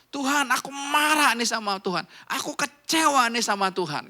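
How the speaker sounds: high, loud acted grievance; angry at God. Believer openly complaining to God, angry and disappointed. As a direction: cry out your disappointment, leaning hard on the feeling words. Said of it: "Kamu mau marah Tuhan, marah aja".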